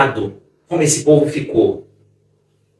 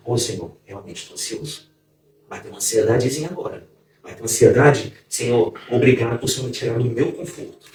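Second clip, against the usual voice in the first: soft confessing prayer; 7 semitones lower, 8 dB quieter than usual. Retold or imitated. Quoted reply to the Spirit.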